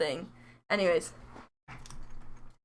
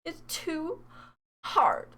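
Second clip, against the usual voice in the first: mock whimper; 5 semitones higher, 5 dB quieter, slower than usual. Small repeat of the mock wail.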